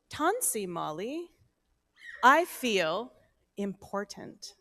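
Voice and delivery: ordinary talk, nothing marked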